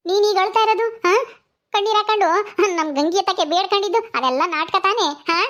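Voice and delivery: teasing Sakamma. Acted. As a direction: exclaim and needle her over the act. Sakamma teases about the fake tears. (ಅಣಕಿಸುವ ಅಚ್ಚರಿಯ ದನಿ)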